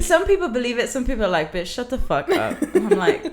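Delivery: amused British accent. Shown as a demonstration. Recounting bar reactions to her act, British accent. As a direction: tell it laughing, in British. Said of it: "I'll talk like this".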